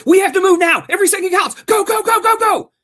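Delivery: high, loud frantic orders; urgent shouting. Pretend. Parodying the show's frantic action scenes with loud urgent shouts. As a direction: bark go go go like a panicked squad leader. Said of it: "constantly shifting between".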